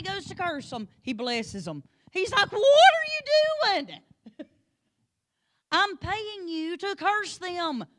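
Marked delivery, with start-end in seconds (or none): 2.1-2.5 excited lead-in. Retold or imitated. Preacher voicing angry king. 2.5-7.8 much higher, louder, slow exasperated yell; mock outraged king. King yelling at Balaam for blessing instead of cursing, hammed-up fury. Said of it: "he's getting ticked off at Balaam".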